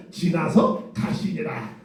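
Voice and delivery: slow, hushed, reverent reading; solemn scripture reading. Shown as a demonstration. Preacher to congregation, reading the verse solemnly and slow. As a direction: intone it like holy writ. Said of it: "거룩하게 표현해줘야 되니까".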